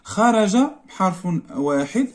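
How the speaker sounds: ordinary talk, nothing marked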